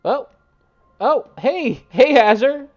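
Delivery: loud, high-pitched welcome to the stream; excited greeting. Real, not acted. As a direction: call out happily to arriving viewers. Streamer calls out greetings to arriving viewers.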